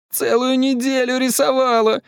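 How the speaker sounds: put-on very high, wailing little girl; nearly crying. A narrator's reading. Катя protests over her spoiled drawings, nearly crying. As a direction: wail it high, on the verge of tears. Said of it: "чуть не плакала Катя".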